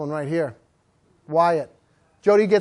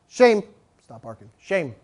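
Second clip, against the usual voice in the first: short, curt reproof; firm guttural correction; 6 semitones lower than usual. Shown as a demonstration. Trainer to barking dog, firm guttural correction. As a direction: correct the barking without yelling. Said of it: "Three guttural ways".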